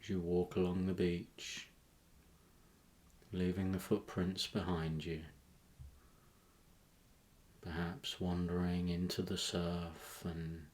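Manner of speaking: calm meditation voice with long pauses; guided meditation. Acted. Host guiding listeners to a tropical beach, guided meditation. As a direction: guide them gently inward, leaving space between phrases.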